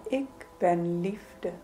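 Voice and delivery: normal talking voice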